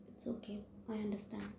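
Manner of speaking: whisper. A narrator's reading. Shy girl answers after kiss.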